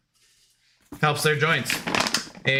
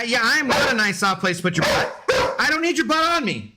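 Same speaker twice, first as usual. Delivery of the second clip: higher sassy dog voice; voicing the sassy dog; 7 semitones higher, faster than usual. Streamer voicing his dog's sassy reply, higher and indignant. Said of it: "Forgive my co-host. She's being a little sassy".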